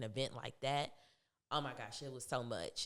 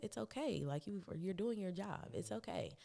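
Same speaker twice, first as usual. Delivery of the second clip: higher, softened consoling voice; retold gentle reassurance; 6 semitones higher, 4 dB quieter than usual. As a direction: gently let the other person off the hook. Retelling herself consoling crying manager.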